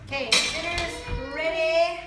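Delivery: loud, slow sung-out call; yelling across the room. Real, not acted. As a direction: call everyone in to dinner. Calling the family to dinner.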